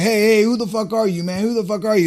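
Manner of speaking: high, loud confrontational shouting; crowd yelling. Retold or imitated. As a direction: gang up on him with a yell.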